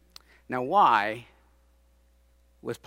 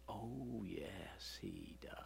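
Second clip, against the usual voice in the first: hushed, breathy aside; whisper; 6 semitones lower, 20 dB quieter, much slower than usual. Acted. Preacher revealing Pilate's fear in a whisper. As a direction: drop to a secret whisper.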